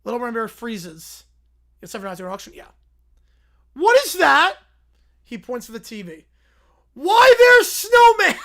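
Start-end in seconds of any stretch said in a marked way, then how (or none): 3.7-4.6 high, loud outraged little bear; Little Brown Bear voice. Acted. Little Brown Bear shocked at the scoreboard, high and loud. 6.9-8.4 high, loud, slow delighted cry, broken up by a laugh; broken-English bear. Acted. Little Brown Bear spotting Snowman on TV, shouting with glee. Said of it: "Why is he talking in broken English?".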